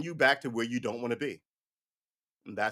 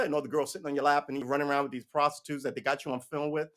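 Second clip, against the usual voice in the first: ordinary delivery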